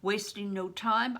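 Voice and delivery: ordinary delivery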